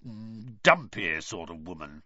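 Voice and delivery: grudging growl of a man who dislikes her. A narrator's reading. Uncle sneering about the letter writer, low growl. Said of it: "he growled finally".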